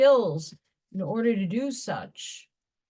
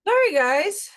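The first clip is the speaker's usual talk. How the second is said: strained after coughing; 9 semitones higher, 6 dB louder than usual. Real, not acted. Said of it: "Water went down the wrong pipe".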